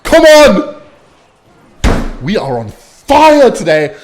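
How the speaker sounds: loud, high yell at the screen; real gamer shout; hype cheer. Real, not acted. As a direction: roar it after the big play. Cheering a game play.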